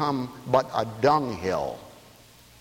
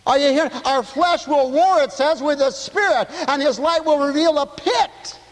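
high, loud preaching call; full-force preaching; 11 semitones higher, 4 dB louder than usual. Acted. Pastor preaching scripture to the radio listeners, fervent. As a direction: preach it out to the congregation.

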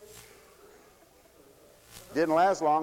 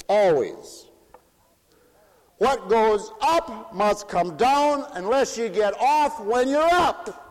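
raised, loud and slow preaching; 11 semitones higher, 5 dB louder, slower than usual. Acted. Preacher warning about conversion downward, raised and slow. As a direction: lay it down slowly like a sermon point.

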